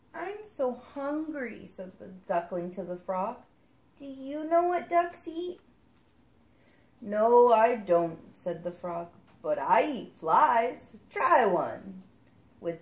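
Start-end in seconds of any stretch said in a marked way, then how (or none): 0.1-1.7 slow little duckling; small hungry duckling; higher duckling telling the frog it is hungry. Acted. Duckling voiced in story. 4.0-5.6 higher little duckling asking; a small duck's question; higher duckling asking the frog. Acted. 7.0-12.0 loud, bold frog; a brash frog booming; the frog answering the duckling, loud. Acted.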